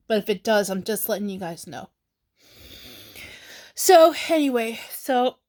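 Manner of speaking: ordinary talk, nothing marked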